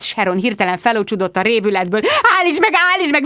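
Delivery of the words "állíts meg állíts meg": high-pitched frantic squeal; screeched panic. A narrator's reading. Sharon lunges at the answering machine, screeching. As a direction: shriek to halt it. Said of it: "visította".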